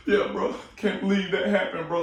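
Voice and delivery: high, loud choked-up fan voice; crying fan bro. Pretend. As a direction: play an overwhelmed moviegoer, voice up high. Imitating a crying stranger in theater.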